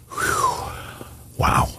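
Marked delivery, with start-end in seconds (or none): 0.1-0.6 high, airy whew; relieved whew. Acted. Preacher after leading the sinner's admission, a relieved whew. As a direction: let out a high exhale of relief.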